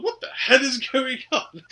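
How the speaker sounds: high-pitched retold outcry; comically indignant, voice pushed high; mock bewildered outcry. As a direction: play Tom baffled by the attack. Tom reacts to being attacked.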